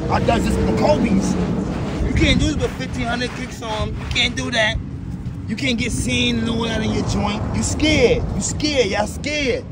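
loud, high-pitched challenge to the doubters; boastful taunting. Real, not acted. Taunts viewers about wearing expensive Kobes.

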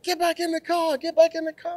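high-pitched imitation of an uptight guard; mock-urgent high command; uptight border guard. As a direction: mimic a tense official ordering someone back. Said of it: "What are y'all uptight about?".

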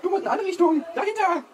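high sing-song call. Pretend. Directing imaginary person outside.

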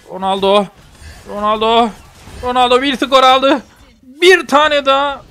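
rising play-by-play, ending high and loud; building commentary. Pretend. Gamer calling own Ronaldo score, building excited commentary.

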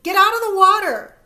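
very loud, high shouted command; urgent yell. A narrator's reading. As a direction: order him out of the water. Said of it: "I yell to the boy".

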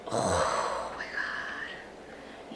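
very slow, low, soft whisper; awed whisper. Real, not acted. Awed by how she looks in sunglasses, whispered. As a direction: breathe it out in awe.

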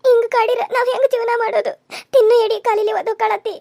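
high-pitched weeping wail; sobbing through a high, anguished complaint; tearful lament. Acted. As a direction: cry out to God in despair. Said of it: "ಅಳಬೇಡ ನೀ".

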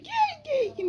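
high coaxing call to a cat; sing-song call to coax the kitten. Real, not acted. (kediyi tiz sesle çağırma)

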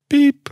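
high, loud mock phone beep; hang-up beep. Imitating the phone cutting off after she says goodbye, beep. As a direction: mimic the line cutting off.

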